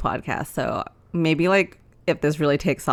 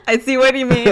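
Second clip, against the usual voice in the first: laughing; 9 semitones higher, 5 dB louder than usual. Real, not acted. Laughing after own impression.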